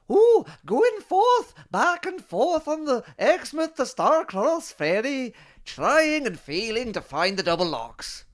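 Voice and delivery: a 1940s Glasgow granny drawing out each word; elderly Glaswegian woman. Pretend. Reading a listener's definition as an old Glaswegian woman. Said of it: "in the voice of an elderly Glaswegian woman from the nineteen forties".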